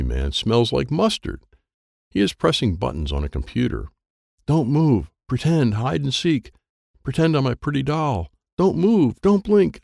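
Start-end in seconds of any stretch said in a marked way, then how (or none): 4.5-9.8 higher tense urgent self-commands; tense self-coaching. A narrator's reading. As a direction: freeze so the man won't see. Frozen hiding, self-talk.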